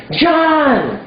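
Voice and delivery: high, eager shouted name; shouted guess. Pretend. Student guessing the boy's name, shouted high. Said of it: "so you guess".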